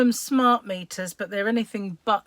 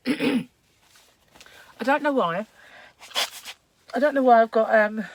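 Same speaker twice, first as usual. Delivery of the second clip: croaky throat, real; rough croaky voice after throat clearing; 3 semitones higher, 5 dB louder, slower than usual. Real, not acted. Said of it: "a croaky voice today".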